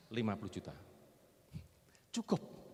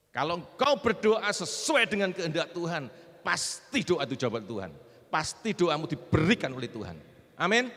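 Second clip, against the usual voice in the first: loud, raised preacher's proclamation; full-force preaching; 7 semitones higher, 12 dB louder, slower than usual. Real, not acted. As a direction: drive each promise home loudly. (khotbah lantang berapi-api)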